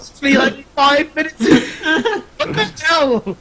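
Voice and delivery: high-pitched, laughing disbelief. Real, not acted. Co-host protesting to the others, laughing. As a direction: crack up while protesting. Cracking up over the time.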